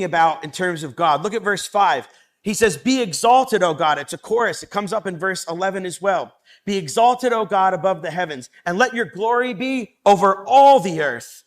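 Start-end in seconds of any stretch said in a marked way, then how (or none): 2.8-4.0 exalted proclamation. Acted. Reads the chorus verse. 6.6-11.4 higher and louder, proclaiming; exalted proclamation. Acted. Reads the chorus again.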